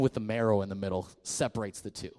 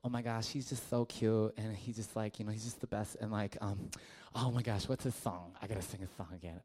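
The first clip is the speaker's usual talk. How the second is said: mock swooning girl; 4 semitones lower, 10 dB quieter than usual. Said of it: "some girls be like".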